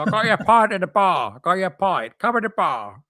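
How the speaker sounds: high, put-on puppet voice; Zippy-like workmate. Workmate calling about a pint, imitated Zippy voice. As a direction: imitate a man who talks like a puppet. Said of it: "he sounded like Zippy".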